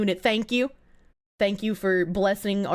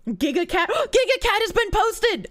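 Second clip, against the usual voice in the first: excited gasp; 9 semitones higher than usual. Real, not acted. Streamer gasps at cat post.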